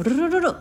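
ルルルル as one run of trilled Rs; rolled R trill. Shown as a demonstration. Describing Indian speakers' English accent, rolled-tongue R trill. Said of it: "巻き舌風っていうんですか".

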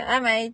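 "Hi, mate" squashed into "a-mite"; quick Aussie "Hi, mate" run into one word; shortened "Hi, mate". Shown as a demonstration. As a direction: drop the h, clip it to "a-mai". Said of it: "さらにそれが短くなって".

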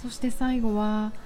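ordinary talk, nothing marked

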